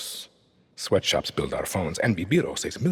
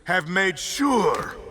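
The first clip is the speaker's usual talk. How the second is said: shout; 12 semitones higher, 3 dB louder, much slower than usual. Acted. Speech climax shout.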